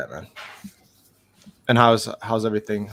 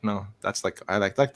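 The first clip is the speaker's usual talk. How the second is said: ordinary talk, nothing marked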